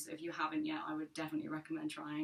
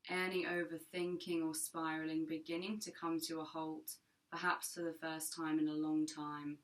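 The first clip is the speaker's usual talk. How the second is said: calm, measured meditation voice with long pauses; guided meditation. Acted. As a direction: guide listeners gently into their breath, unhurried and even.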